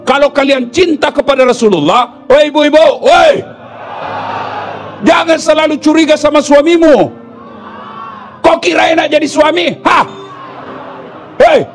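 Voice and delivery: preacher shouting a warning to the wives; calling out to the wives. Real, not acted. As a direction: rebuke the wives at full force.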